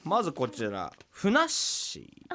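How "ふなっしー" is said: Funassyi voice. Pretend. Showing the Funassyi impression.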